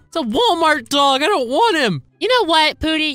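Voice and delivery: ordinary delivery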